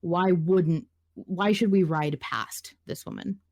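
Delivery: ordinary talk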